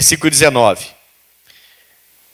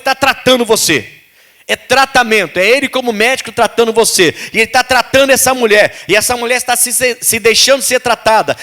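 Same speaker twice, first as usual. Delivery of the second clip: raised, insistent preacher voice, pleading with the flock; fervent preaching; 5 semitones higher than usual. Acted. Pastor urging the congregation to surrender, fervent preaching. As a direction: drive it home like a pastor at full force.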